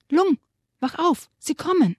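rat's urgent cry to rouse her friend; urgent call. Acted. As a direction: rat urgently calling the sleeping dragon awake. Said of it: "rief sie".

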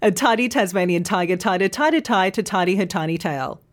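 rapid-fire twister with clean t sounds; fast tongue twister. Shown as a demonstration. Teacher showing how hard the twister gets at speed. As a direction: rattle it off fast. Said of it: "the faster you say them".

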